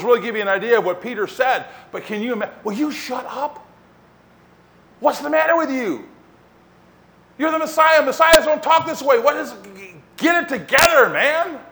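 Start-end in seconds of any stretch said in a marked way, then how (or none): 2.6-11.6 high-pitched, exasperated scolding; indignant rebuke. Retold or imitated. Peter takes Jesus aside to rebuke him, indignant and high. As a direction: rebuke him in raised, agitated tones. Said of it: "he starts to rebuke him".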